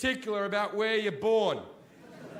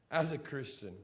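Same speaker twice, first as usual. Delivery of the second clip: mock pious opener; 3 semitones lower, 6 dB quieter than usual. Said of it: "they start sentences like this".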